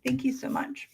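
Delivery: ordinary delivery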